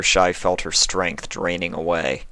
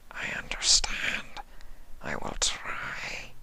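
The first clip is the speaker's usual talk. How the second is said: slow breathy alien whisper; hushed low creature voice; whispering changeling; 8 semitones lower, 12 dB quieter, slower than usual. Acted.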